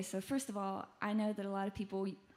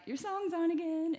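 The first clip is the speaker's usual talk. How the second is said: imitating an excited dad, higher pitch; dad's excited call; 8 semitones higher than usual. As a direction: announce the news like a thrilled dad. Proud dad calling with news.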